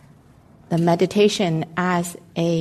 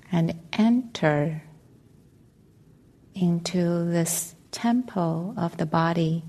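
calm, measured meditation voice with long pauses; guided meditation. Acted. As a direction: guide listeners gently into stillness, leaving space between phrases.

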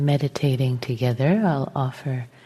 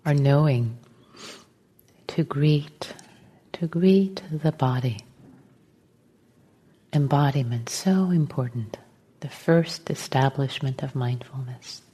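guided meditation; 3 dB quieter than usual. Real, not acted. Teacher settling the group into meditation, calm and unhurried. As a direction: gently guide them into stillness, phrase by phrase.

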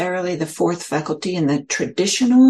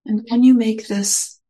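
guided meditation. Acted. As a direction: ease listeners into their posture, unhurried.

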